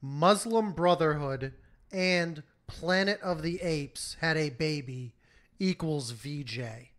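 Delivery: slow, measured read-out of a tweet; deliberate quote reading. Real, not acted. Co-host quoting the offending tweet to the others, slowly and deliberately. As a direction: read it out word for word, with heavy pauses. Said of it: "and I quote".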